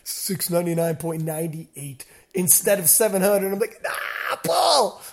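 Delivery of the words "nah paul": high, pleading cry at a screen; strained, helpless mock holler; retold yelling. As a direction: re-enact a yell at the stream without full volume. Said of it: "I'm yelling at the stream".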